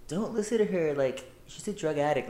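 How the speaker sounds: high, put-on mocking girl voice; mocking Cassie voice. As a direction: sneer it as a fake two-faced girl. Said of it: "she was like".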